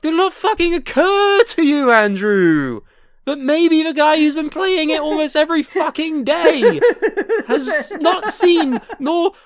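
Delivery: loud, high laughing tirade; incredulous rant. Real, not acted. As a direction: rant in disbelief, cracking up.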